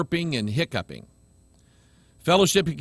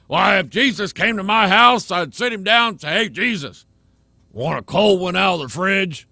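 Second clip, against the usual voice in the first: mocking a casual beer-drinking believer, getting louder and higher; hammed-up excuse-maker rising to a loud higher pitch; mock drinking churchgoer; 3 semitones higher, 4 dB louder than usual. Preacher mocking a listener who defends a beer. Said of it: "You can say".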